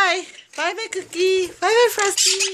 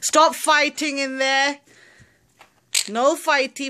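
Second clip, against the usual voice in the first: normal talking voice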